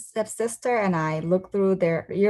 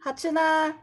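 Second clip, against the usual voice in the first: roll call; 9 semitones higher than usual. Acted. Teacher calls her name.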